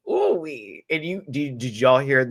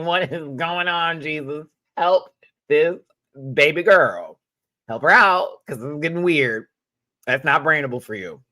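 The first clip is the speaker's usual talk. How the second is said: retold plea; 4 semitones higher, 6 dB louder than usual. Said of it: "I was like".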